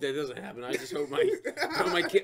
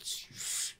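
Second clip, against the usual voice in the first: unvoiced hushing shush; 10 dB quieter than usual. Daughter hushing dad's silly accent, whispered. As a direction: hush him quickly, breathy. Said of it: "She's like".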